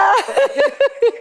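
squealing laugh; high burst of laughter. Real, not acted. Host laughing at being mistaken for someone.

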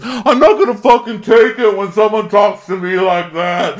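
high, loud furious outburst; mock fury, voice up high; angry quote. Emily losing it outside, mock furious. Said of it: "And she goes".

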